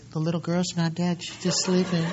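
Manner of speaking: hushed, meek little voice; timidly gentle parody, very soft; mock soft-spoken. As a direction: say it like a weak little guy afraid to be heard. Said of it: "Do you think he goes".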